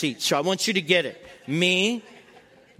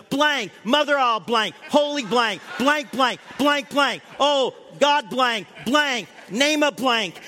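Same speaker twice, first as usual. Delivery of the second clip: higher, shouted mock swearing with bleeped words; hammed-up frantic cursing; 5 semitones higher, slower than usual. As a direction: yell the bleeps like a panicked passenger. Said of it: "this lady sitting right next to him goes".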